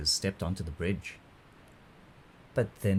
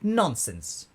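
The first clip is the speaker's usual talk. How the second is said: slightly raised, dismissive mother's rebuke; a touch of scolding, higher voice; mild scolding; 6 semitones higher, 3 dB louder than usual. A narrator's reading. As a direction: brush it off as a stern mother. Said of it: "Their mother scolded".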